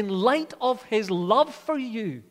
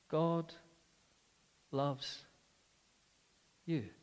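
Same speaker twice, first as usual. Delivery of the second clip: tender; 3 semitones lower, 9 dB quieter, much slower than usual. Real, not acted. Preacher's tender aside to congregation.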